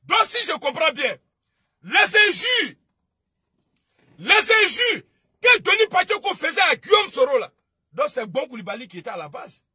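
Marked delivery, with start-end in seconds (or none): 1.8-7.4 loud, high indignant shouting; angry shout. Real, not acted. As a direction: yell it out in outrage. Angry rant against insulting rivals. (crie avec indignation, plus fort et plus aigu)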